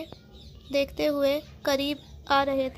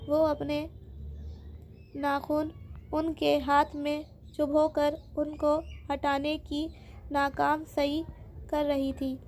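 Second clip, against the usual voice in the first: ordinary delivery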